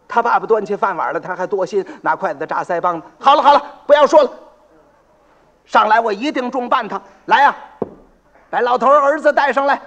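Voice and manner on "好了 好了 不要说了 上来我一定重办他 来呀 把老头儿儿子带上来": loud, fast impatient order; impatient magistrate. Acted. County magistrate cuts the father off, impatient and loud. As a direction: cut him off and bark for the son.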